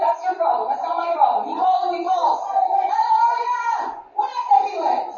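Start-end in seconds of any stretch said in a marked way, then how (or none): 1.5-5.0 preaching shout. Real, not acted. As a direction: stretch the hallelujah out. Preaching climax.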